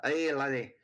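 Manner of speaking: a light Scottish burr; Scottish accent. Retold or imitated. Player imitates the Scottish bartender's greeting in Scottish accent. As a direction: toss off a mock Scottish aye. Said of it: "A lot of Scottish accents going on".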